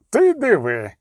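mock her with feigned amazement. A narrator's reading. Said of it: "спробував узяти її на кпини".

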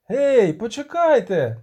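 high, loud, stretched call; calling after. A narrator's reading. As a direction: call out to make them wait. Bard calls after departing riders. (протяжний високий оклик)

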